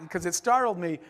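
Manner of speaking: normal talking voice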